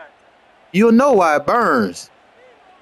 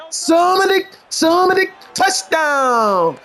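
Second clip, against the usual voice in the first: excited call; 10 semitones higher, slower than usual. Real, not acted. Celebrating a touchdown in game.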